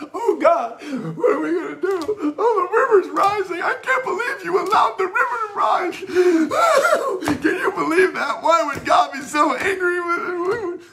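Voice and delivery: high, wailing mock lament; whining complainer. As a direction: mimic a panicking whiner crying to God. Mocking whiners in the flood.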